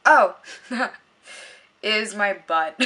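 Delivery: said through an embarrassed laugh; laughing while admitting it. Real, not acted. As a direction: giggle it out, a little sheepish. Embarrassed admission between laughs.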